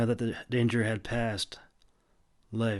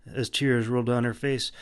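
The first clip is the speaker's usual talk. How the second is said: ordinary delivery, nothing marked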